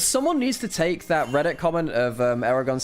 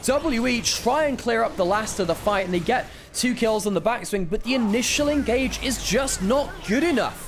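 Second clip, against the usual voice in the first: rising excited esports cast, pitched up; fast-building caster energy; live play-by-play; 9 semitones higher than usual. Acted. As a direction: call the teamfight as it happens.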